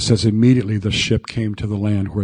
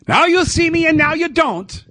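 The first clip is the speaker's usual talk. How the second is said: much higher mock teasing sing-song; teasing taunt. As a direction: tease like hide and seek. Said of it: "didn't play games with them".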